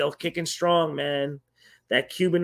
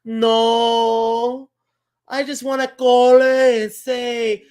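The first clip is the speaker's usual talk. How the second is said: imitating grandma; 5 semitones higher, 8 dB louder, much slower than usual. Grandma voiced on phone.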